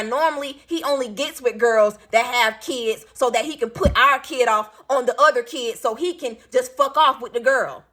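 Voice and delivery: usual speaking voice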